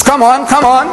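high, mocking come on; gloating taunt. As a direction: taunt him, sure of the win.

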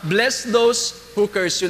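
mabagal at mahinang pagbigkas ng "Bless those who curse you"; slow quote. Shown as a demonstration. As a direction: bigkasin ang bawat salita nang dahan-dahan at may bigat. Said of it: "tingnan nyo lang po". (dahan-dahang pagbasa ng talata)

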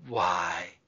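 put-on old gardener caught off guard; understated outcry; cried out. A narrator's reading. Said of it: "he cried out".

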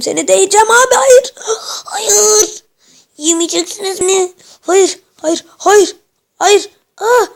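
high, loud, crying "hayır" screams; panicked screams. Real, not acted. Gamer begging the monster not to eat him, panicked screams.

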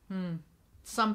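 talking while eating; muffled speech between bites; mouth full of jelly. Real, not acted. Seen 3: spoons red jelly into mouth at 1.1, chews closed-mouthed to 2.8.

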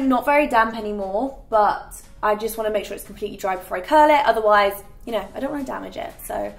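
ordinary delivery

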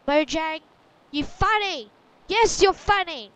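loud, exaggerated mock telling-off; mock scolding. Player telling off Mojang for the joke, loud mock scolding. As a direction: call them out at full volume, playfully offended.

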